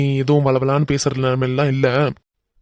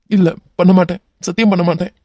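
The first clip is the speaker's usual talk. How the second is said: higher, louder, swearing; frantic promise; 3 semitones higher, 3 dB louder than usual. Acted. Captive man swearing to the hero, frantic promise. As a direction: swear it desperately.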